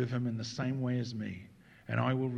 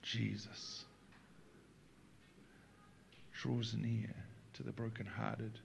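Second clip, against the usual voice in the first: hushed preaching; 7 dB quieter than usual. Real, not acted. Pastor comforts the brokenhearted, hushed preaching. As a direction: let each phrase settle quietly.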